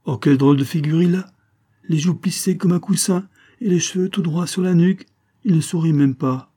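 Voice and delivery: high, loud, lively mockery; amused teasing. A narrator's reading. Émilie making fun of the baffled lieutenant, amused teasing.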